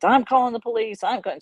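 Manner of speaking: imitating her yelling, loud and high; mild mock yell at the dog owner; retold scream. Lady yells at the dog owner, loud and high. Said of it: "she's screaming".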